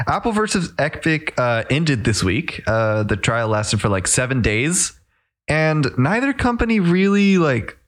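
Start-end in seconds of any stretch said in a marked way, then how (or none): none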